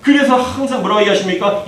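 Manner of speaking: ordinary delivery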